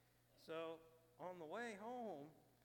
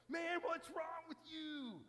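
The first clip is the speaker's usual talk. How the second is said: much higher and louder, slow, hammering; mock scream; 12 dB louder, slower than usual. Buddy yelling at the drunk to accept Jesus, imitated loud scream. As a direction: yell the man into faith. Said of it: "screaming at this guy".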